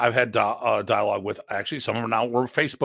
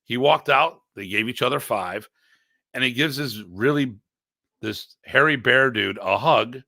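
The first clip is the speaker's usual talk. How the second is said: ordinary talk, nothing marked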